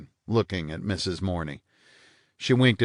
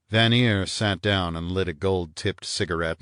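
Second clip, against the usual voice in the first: plain reading voice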